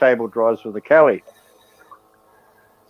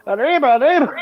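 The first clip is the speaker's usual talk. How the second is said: high hammed-up Arriba, arriba cry; mock cartoon Mexican; 12 semitones higher than usual. Retelling the phone call to Texas, high mock cartoon Mexican cry. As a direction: yelp it like a cartoon mouse on the run.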